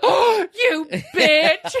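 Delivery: hammed-up high, drawn-out shriek; mock outrage. Boyfriend betrayed in court, melodramatic outrage. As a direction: stretch every word in a high, scandalized wail.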